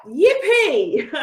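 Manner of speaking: high, loud gleeful whoop then laugh; excited yippee. Real, not acted. Coach cheering her class on, gleeful yippee. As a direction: cheer it like a small victory. Coach celebrating getting real.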